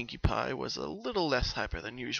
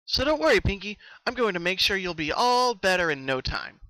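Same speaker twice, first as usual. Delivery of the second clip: much higher, louder bright promise; confident reassurance; 8 semitones higher, 9 dB louder than usual. Acted. Twilight reassuring worried Pinky, confident. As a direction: promise it with a big smile. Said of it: "a confident smile".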